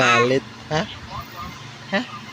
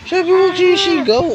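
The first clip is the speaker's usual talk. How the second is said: high, a bit louder mock warning; high playful scold; 11 semitones higher, 4 dB louder than usual. Real, not acted. Parent scolding baby playfully.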